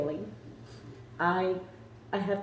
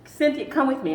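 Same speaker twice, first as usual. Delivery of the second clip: higher, louder eager call; excited call; 6 semitones higher, 5 dB louder than usual. Retold or imitated. To Cynthia, showing she can run, excited call. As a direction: summon her with bubbling joy. Calling friend to show running.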